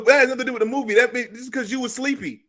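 high, loud, worked-up protest; excited protest. Real, not acted. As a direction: protest it, half laughing. Heated laughing protest in the argument.

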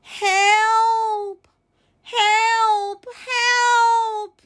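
high, drawn-out calls; acted distress call; crying for help. A narrator's reading. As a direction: call out for rescue, holding each word. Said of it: "cried the little blue truck".

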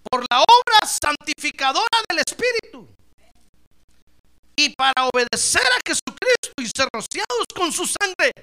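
booming Bible reading; forceful scripture reading. Acted. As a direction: declaim the verse to the church.

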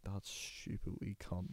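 muttered scoffing whisper. Retold or imitated. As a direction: hush it like a sneering aside. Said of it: "looking at me like".

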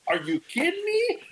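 high, incredulous retold outburst; can't believe what he just saw; amazed. Retold amazement at the pointing dog.

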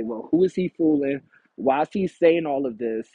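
ordinary talk

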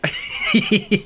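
very high-pitched laugh; squealing laugh. Real, not acted. Big laugh.

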